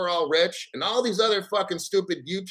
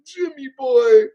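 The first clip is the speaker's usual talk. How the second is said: high, loud fake blubbering; mock sobbing; 8 semitones higher, 9 dB louder than usual. Taunting the host with loud, high fake sobs. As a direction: sob it out in mockery.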